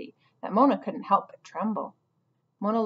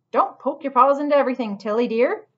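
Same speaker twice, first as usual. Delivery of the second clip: a lightly voiced porcupine cook, higher and louder, chiding; 5 semitones higher, 7 dB louder than usual. Cook chiding the squirrel for picking at food, mildly scolding. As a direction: scold gently but firmly, like a fussy cook. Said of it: "chided the porcupine".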